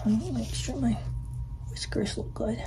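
hushed grooming mutter; soft whisper fixing whiskers; whispered aside. Acted. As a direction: whisper to yourself while primping.

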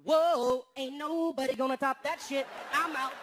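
loud, high dazed exclaim; bow out in amazement; hammed-up star giving up; stunned awe. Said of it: "Kelly pops out his head and goes".

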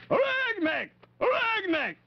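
high, ringing war cry. Acted. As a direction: call it out like a rallying chant. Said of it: "I see you know about my Aragnac cheer".

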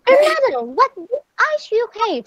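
put-on high-pitched little girl's voice; high, wondering storybook girl. A narrator's reading. Red marvels at the disguised wolf.

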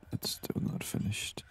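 ordinary talk

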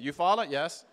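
imitated Southern US accent, loud. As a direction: answer like a small-town Georgia Southerner. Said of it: "they talk like this".

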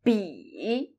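"Bi" from a very low start, dipping down then up; third tone bi. Shown as a demonstration. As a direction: sink low on "Bi" before rising. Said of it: "start from a very low end of the vocal range".

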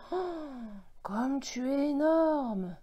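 slow, high-pitched gasp of wonder; awed amazement. A narrator's reading. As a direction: marvel at something huge, stretching the words. Child gazes up at giant baobab.

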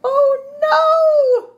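high, shouted storybook gasp of alarm; teacher hamming up alarm for the kids; mock alarm. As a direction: cry out as if the letters are falling. Letters tumbling out of tree.

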